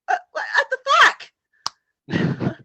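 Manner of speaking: high, very slow disbelief; imitating shocked confusion; drawn-out baffled what; bewildered mock reaction.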